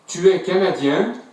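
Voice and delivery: normal talking voice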